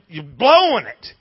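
ordinary talk, nothing marked

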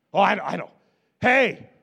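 loud, eager outburst, half-joking; eager blurt. Retold or imitated. Pastor acting a disciple who blurts out an answer, loud and eager. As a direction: jump in like the know-it-all disciple.

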